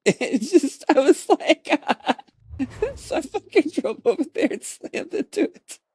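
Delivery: high, words broken by laughter; can't stop giggling; laughing. Real, not acted. Retelling, still laughing.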